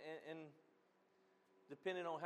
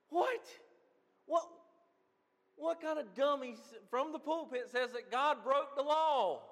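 high, loud retold disbelief; incredulous outburst; 9 semitones higher, 8 dB louder than usual. Reacting to a pastor's claim about God, incredulous. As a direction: blurt a startled "what", then build into indignant disbelief. Retells his shocked reaction to sermon.

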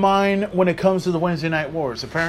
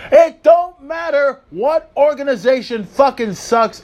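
mocking rant; 8 semitones higher, 4 dB louder than usual. Real, not acted. Ranting list of promotions.

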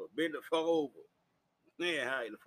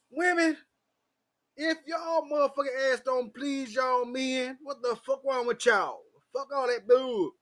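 raised, louder scolding; heated telling-off; 8 semitones higher, 5 dB louder than usual. Acted. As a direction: tell them off in a higher, pushed voice. Tells off other women.

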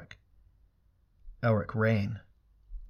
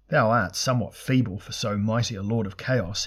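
ordinary delivery, nothing marked

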